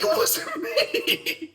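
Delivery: very high, slow squeaky denial, laughing; mock innocent squeak. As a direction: play it innocent in a tiny squeak. Boy claims innocence.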